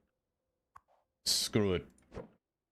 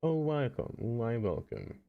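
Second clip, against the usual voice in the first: slow, odd put-on welcome in a strange voice; slow odd welcome in a silly voice; weird put-on voice; slower than usual. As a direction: stretch it out in a goofy stranger's voice. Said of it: "I don't know why I said it like that".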